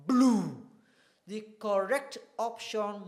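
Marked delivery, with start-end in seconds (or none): 0.1-0.7 blue said loud and firm; stressed word. Shown as a demonstration. Teacher stressing the word for the class, loud. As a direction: punch blue. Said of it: "I stress".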